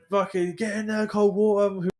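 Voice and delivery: high mock bark of an order; imitating marshals. Recalling marshals ordering them into the water, high mock yell. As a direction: play a marshal yelling them on. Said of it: "they went".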